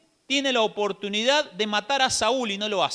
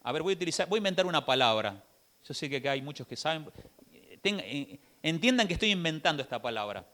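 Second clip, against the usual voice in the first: softer, lower side remark; quiet aside; 6 semitones lower, 7 dB quieter, faster than usual. Real, not acted. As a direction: drop out of the sermon for a moment. Aside about inventing a word. (aparte en voz baja)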